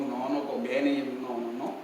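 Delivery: quietly turning it down, slowly; hushed refusal. Retold or imitated. The couple quietly telling each other the timeshare isn't worth it.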